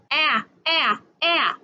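short, separate "ah" like the a in ant; teacher modelling the "ah" letter sound, as in apple. Shown as a demonstration. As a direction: say "ah" as a crisp short-a, one at a time. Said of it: "A makes the sound".

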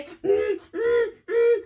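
high revving hum; short playful engine bursts; imitated car engine. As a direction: rev like a toy car. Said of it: "give yourself a driving clap".

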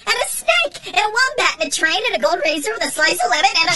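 very high squeaky list, racing fast; rapid high rambling. A narrator's reading. Robot listing wants to Jesse, high and racing. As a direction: rattle off wishes like an excited kid.

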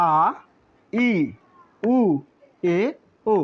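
আ, ই, উ, এ, ও spaced out for learners to copy; pronunciation demo. Shown as a demonstration. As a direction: read আ, ই, উ, এ, ও one vowel at a time, with pauses. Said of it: "তবু আমি একবার পড়ে দিচ্ছি".

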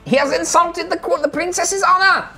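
outraged tiny fairy, loud and very high; high-pitched warrior captain. Acted. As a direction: squeak out a rousing battle cry. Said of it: "kind of high-pitched voice".

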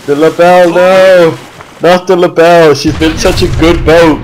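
loud mock-tragic cry for the boat; anguished cry. Real, not acted. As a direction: wail over losing the ship. Boat being destroyed.

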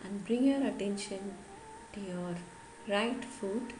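slow, measured yoga nidra guidance; guided meditation. Acted. Yoga teacher guiding listeners to relax the whole body, guided meditation. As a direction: lead them gently through the body, phrase by phrase with long pauses.

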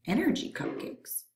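dreamy whisper. Acted. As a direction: breathe it like a sweet dream.

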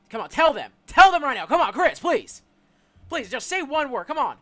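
loud, high urgent begging; desperate pleading. Real, not acted. Pleading with dying character.

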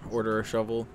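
ordinary talk, nothing marked

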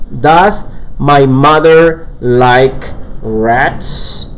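very slow, drawn-out English question; rising, careful dictation; slow model sentence. Shown as a demonstration. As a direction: spell it out word by word for the class. Teacher models question slowly.

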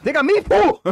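high thrilled call of the name; excited shout. Real, not acted. Gamer cheering a teammate's goal: high excited shout.

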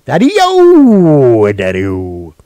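loud call across to Daddy-O. Real, not acted. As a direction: shout for Daddy-O. Calling out to father.

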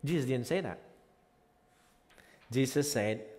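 ordinary talk, nothing marked

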